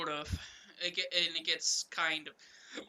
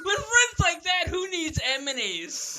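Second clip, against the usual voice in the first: much higher, louder melodramatic wail; dramatic lament; 11 semitones higher, 7 dB louder than usual. Acted. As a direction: overplay the betrayal, slow ending.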